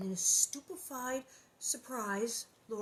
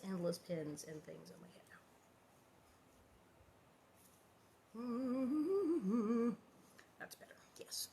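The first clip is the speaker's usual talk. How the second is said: whispering; 9 dB quieter, faster than usual. Real, not acted. Fixing hair, muttering to herself.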